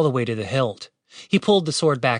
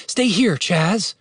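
higher, slowed instruction; slow, raised-pitch command; taking charge; 6 semitones higher, much slower than usual. A narrator's reading. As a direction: give a firm order to stay put. Julian giving orders before rescue.

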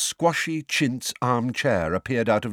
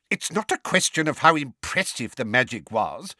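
higher-pitched testy objection; 6 semitones higher than usual. A narrator's reading. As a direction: object testily. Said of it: "in a testy voice".

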